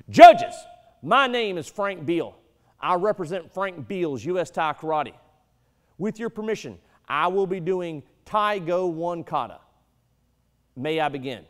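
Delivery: loud formal announcement; strong and loud. Shown as a demonstration. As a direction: announce yourself boldly, projecting high. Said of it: "I'm gonna go ahead and do it one time strong".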